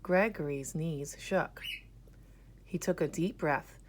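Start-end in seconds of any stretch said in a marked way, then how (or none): none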